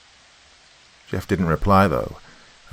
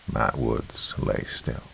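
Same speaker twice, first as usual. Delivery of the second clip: ordinary delivery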